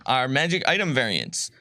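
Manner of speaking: ordinary talk, nothing marked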